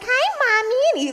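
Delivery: put-on very high, sweet little-boy voice; squeaky mama's-boy greeting; babyish kid voice. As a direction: mimic a kid sucking up to mom. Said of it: "he actually talks like that".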